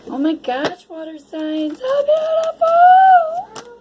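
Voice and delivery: high delighted coo, swelling to a loud, drawn-out finish; delighted gushing. Real, not acted. Tarot reader reacting to the water signs' cards, delighted gushing. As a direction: gush in a high voice, stretching the last words.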